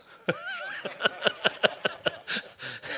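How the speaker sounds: high, airy burst of laughter. Real, not acted. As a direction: crack up at the joke. Preacher cracks up at his joke.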